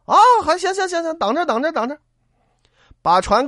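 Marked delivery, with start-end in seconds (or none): 0.1-2.0 high, quick eager agreement; eager boatman. Acted. Boatman sweetly promising the fugitives, high and eager. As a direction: sound all too willing.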